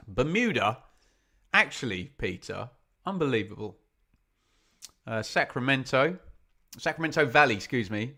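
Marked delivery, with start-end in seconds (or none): none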